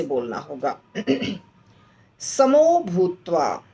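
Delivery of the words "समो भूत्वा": slow recitation. Shown as a demonstration. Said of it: "वहाँ पे फिर से पॉज".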